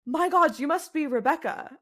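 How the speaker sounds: startled townsman. Acted. Townsman turns around to the newly arrived giant, startled recognition. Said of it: "He is gonna turn around and go".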